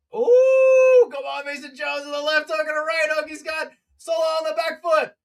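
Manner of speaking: rising hype as the hooks land; excited shout. Real, not acted. Commentator hyped as Mason Jones lands hooks.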